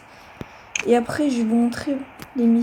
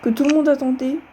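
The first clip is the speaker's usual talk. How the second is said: normal talking voice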